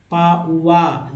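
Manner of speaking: पाउआ drawn out very slowly; very slow model word. Shown as a demonstration. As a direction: stretch पाउआ syllable by syllable. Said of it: "P A U A". (बहुत धीमे पाउआ)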